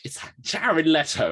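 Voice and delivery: high, loud, drawn-out mock dismay; hammed-up indignant discovery; mock outrage. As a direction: exclaim it slowly in comic disbelief. Retells indignant reaction to advert.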